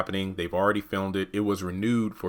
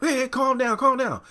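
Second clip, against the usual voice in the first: mock calming down. Real, not acted. Reacting to quoted rant.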